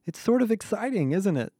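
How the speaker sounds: high-pitched, smiling delight; excited, smiling. A narrator's reading. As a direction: say it high, with a smile. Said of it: "she whispered, suddenly smiling".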